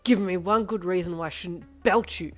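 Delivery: dad threatening his daughter, yelling; angry yell. Acted. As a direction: yell it at the cowering kid. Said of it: "he yelled".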